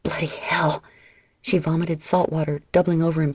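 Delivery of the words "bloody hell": hoarse whispered curse through pain. A narrator's reading. Injured sister whispering a curse in pain. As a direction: gasp it out under your breath, hurting. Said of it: "Livia whimpered".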